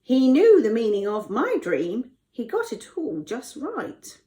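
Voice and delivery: high, loud character voice, easing at the end; cupbearer voice. Acted. Cupbearer recommends Joseph to Pharaoh, high character voice. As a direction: pipe up eagerly as the cupbearer. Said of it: "the cupbearer said".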